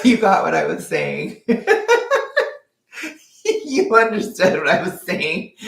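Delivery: laughing through the words; real giggle while talking. Real, not acted. Laughing at own slip.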